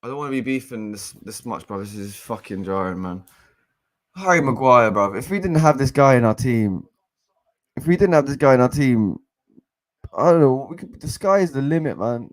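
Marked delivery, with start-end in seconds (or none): 4.2-12.3 loud, high, heated fan rant; frustrated rant. Real, not acted. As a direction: vent at the player, full voice. Fan rants about defender.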